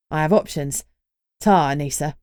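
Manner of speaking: cheeky young Cockney lad, lightly voiced; a grinning Cockney introduction; Cockney accent. Reggie's Cockney voice.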